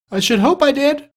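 very high, pert lad; boy's voice. A narrator's reading. Lad answering Scrooge about the shop, boyish voice. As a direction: answer in a piping boy's pitch. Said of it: "replied the lad".

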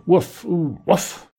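sleepy mock woof woof; dog bark in sleep. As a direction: bark like a dreaming dog, not full out. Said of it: "Then he barked loud".

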